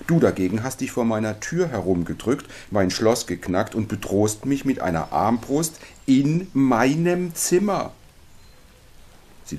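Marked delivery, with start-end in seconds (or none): 0.1-7.9 indignant accusation. Acted. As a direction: pile up the charges with mounting outrage. Indignant accusation in story dialogue. (empört, immer höher, am Ende gedehnt)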